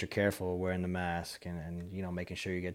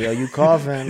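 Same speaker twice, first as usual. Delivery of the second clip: loud mom voice; 6 semitones higher, 16 dB louder than usual. Retold or imitated. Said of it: "Mom says that to me too".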